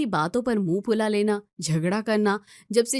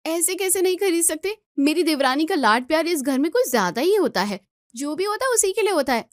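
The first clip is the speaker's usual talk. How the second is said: higher, aggrieved complaint; jealous outburst; 7 semitones higher than usual. Acted. Elder bride complains to mother-in-law, aggrieved and higher.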